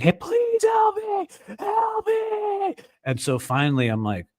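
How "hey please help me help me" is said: high, slow desperate call for help; imitated pleading scream. Stranger begging for help from the stairs, high mock scream. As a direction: plead high and far like the man outside. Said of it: "this guy's like".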